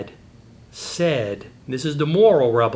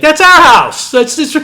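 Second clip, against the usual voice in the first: much higher and louder, incredulous; excited exclaim; 14 semitones higher, 13 dB louder, slower than usual. Retold or imitated. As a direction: blurt it out high and loud in disbelief. Dad exclaims to his kids.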